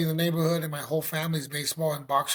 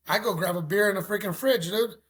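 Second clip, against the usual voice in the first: ordinary delivery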